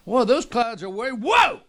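rising, very high astonished cry; amazed whoa. Retold or imitated. To the jump master while falling past clouds, high amazed cry. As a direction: gasp at the clouds far below. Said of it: "and I".

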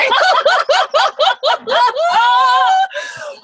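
high, loud cackling laugh; shrieking laugh. Real, not acted. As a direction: burst into a long, shrill laughing wail. Ranting over remembered dinner insult.

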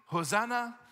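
called out high and bright, like a crowd cheering; a crowd's glad cry; joyful Hosanna. Retold or imitated. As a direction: lift it like a shout of praise. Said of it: "they were singing".

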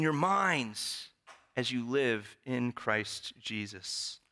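solemn Bible reading, slowing at the end; scripture reading. Acted. Pastor reading Philippians aloud to the church, solemn. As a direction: read the passage like a sermon reading.

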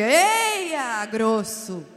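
loud teasing "grosso"; mock scolding. Wife mock-scolding her husband as rude. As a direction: tease him for being rude.

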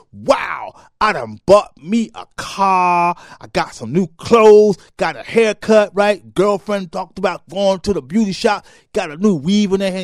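loud, high boasting; loud bragging. Retold or imitated. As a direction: brag about the new stuff.

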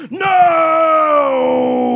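loud high shout. Retold or imitated. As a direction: bark a sharp no. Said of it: "And he says".

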